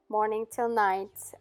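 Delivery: usual speaking voice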